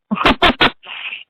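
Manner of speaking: brief breathy chuckle; amused little laugh; short laugh. Real, not acted. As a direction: laugh at your own story. Laughs at own retold refusal.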